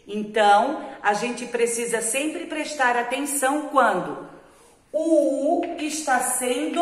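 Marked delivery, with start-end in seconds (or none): none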